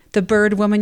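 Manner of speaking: normal talking voice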